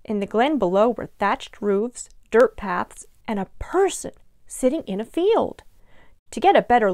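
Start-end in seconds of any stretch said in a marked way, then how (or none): none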